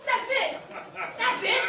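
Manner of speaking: high, eager exclamation; excited relief. Acted. Traveler hearing his ship named, excited. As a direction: seize on it with delight.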